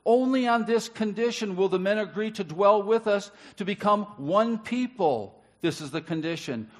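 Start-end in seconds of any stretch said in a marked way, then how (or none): 0.0-5.4 high, loud proclaimed scripture; preaching. Acted. Preacher reading Genesis aloud to the congregation, forcefully. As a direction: read it out boldly to the church.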